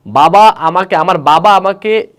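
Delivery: high, loud acted cry; loud shout. Girl telling villagers of her marriage, loud shout. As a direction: shout it out, voice rising on each phrase. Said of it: "তো জোর চিৎকার দিয়ে বলছে".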